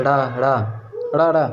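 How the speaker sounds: ड़ा with the tongue tip flicked down; flap demo. Shown as a demonstration. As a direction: snap each ड़ा out. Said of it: "जीवा की नोक झटके से गिर जाती है". (उत्क्षिप्त ड़ा)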